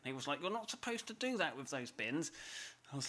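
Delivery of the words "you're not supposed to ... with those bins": lightly voiced higher Jobsworth. Passer-by objecting to bin use, lightly mimicked Jobsworth. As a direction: put on a mild officious busybody. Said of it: "the most Jobsworth voice".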